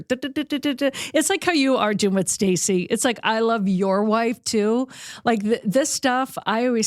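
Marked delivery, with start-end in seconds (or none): 0.0-0.9 high, rapid-fire tracking blips; little tracker noise. Retold or imitated. Host imitating how he follows her around, little tracker noise. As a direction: imitate a small tracker ticking along, pitched up. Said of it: "it's like the little tracker".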